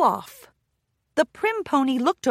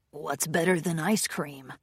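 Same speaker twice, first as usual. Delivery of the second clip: low, soft gruff pirate; 5 semitones lower, 6 dB quieter than usual. Acted. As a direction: mutter like a rough pirate. Lickspittle wonders aloud.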